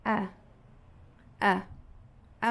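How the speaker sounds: "a" as a short, weak uh; brief unstressed uh for "a"; unstressed schwa. Shown as a demonstration. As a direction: let "a" drop to a slack neutral uh. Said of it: "átona".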